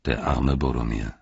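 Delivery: low, grave regret; sober, subdued pity; suddenly very serious. Acted. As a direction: drop low and speak gravely. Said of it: "wurde dann plötzlich sehr ernst".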